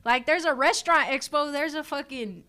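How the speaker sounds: high, loud, impassioned protest; fired-up indignation. Real, not acted. Barber arguing his trade deserves expos too, fired-up indignation. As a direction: argue it loudly, pitch jumping up.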